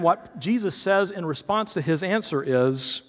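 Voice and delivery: ordinary delivery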